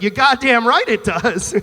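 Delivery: loud, drawn-out, hammed-up agreement; emphatic agreement. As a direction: agree with slow, loud relish. Eager captive answers the kidnapper.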